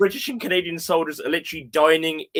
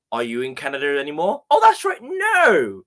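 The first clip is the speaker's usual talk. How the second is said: sarcastic mock realisation; 3 dB louder than usual. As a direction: sneer at the failed invasion in a put-on squeal. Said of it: "It's like".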